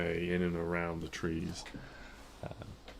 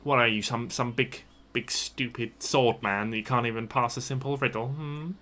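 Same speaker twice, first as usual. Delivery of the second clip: louder goblin jeer; goblin mockery; 5 semitones higher, 13 dB louder than usual. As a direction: taunt the swordsman like a goblin. Goblin insults the paladin.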